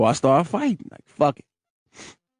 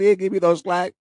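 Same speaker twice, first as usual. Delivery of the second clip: ordinary delivery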